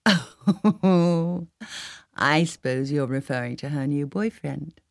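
amused, laugh breaking into the words. A narrator's reading. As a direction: chuckle through it, teasing. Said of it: "Paula began to laugh".

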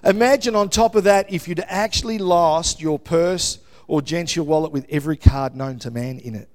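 normal talking voice

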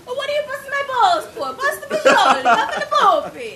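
high, loud, laughing fake Italian accent; mock Italian gibberish. Shown as a demonstration. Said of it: "sound like you're speaking Italian".

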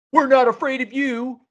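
put-on high, loud defiant kid; defiant child voice. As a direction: voice a bold little child. Children defy the Gorgon.

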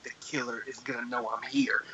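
low, very soft retold worry; hushed fear. Teller relives hiding in the car, hushed. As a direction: drop it to a secretive hush.

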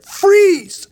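very high, loud yelled command; sudden police shout of freeze; cop yelling. Retold or imitated. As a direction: bark it like a cop with a gun drawn. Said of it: "all of a sudden I hear".

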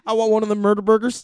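high, loud, fast orc. Pretend. Orc ordering at a burger joint, orc voice. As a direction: blurt it like a hungry game orc. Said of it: "could do an orc voice".

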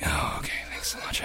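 groggy whisper; sleepy guest. Retold or imitated. Guest woken at three answering in a groggy whisper. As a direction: mumble half asleep.

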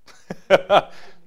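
airy high laugh; breathy laugh. Real, not acted. Laughing at the group for being so emotional.

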